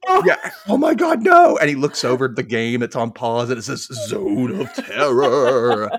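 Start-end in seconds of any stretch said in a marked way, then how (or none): 0.1-1.6 high, loud hammed-up despair; mock horror. Acting out Steve's horror at cutoff. 4.1-5.9 slow, loud, drawn-out mock menace; video game announcer. Voicing the paused video game.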